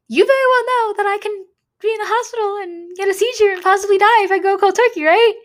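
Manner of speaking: high, strained and insistent; pleading outrage. Retold or imitated. Pressing her doctor about the seizure risk, high and insistent. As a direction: push it up high, demanding he admit it.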